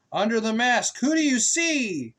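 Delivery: rising, questioning refrain; sing-song refrain. A narrator's reading. Asking the child the book's refrain, sing-song.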